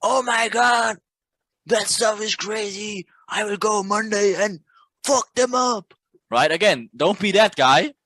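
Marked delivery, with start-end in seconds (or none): 0.0-5.9 higher, hyped mock voice; reckless impatient trader. Coach mocking a reckless impatient trader, higher hyped voice. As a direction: ham up a cocky reckless trader. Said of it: "reckless and impatient".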